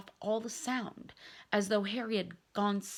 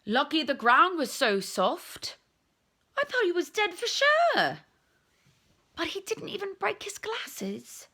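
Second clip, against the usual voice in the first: a hint of relieved chatter, higher; lightly voiced onlookers; 10 semitones higher, 6 dB louder than usual. Teammates murmuring over Harry, relieved chatter. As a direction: voices talking over the fallen boy.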